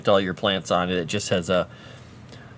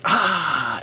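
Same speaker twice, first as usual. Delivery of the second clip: imitating pet attack cry; 7 semitones higher than usual. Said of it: "he's, like, immediately like".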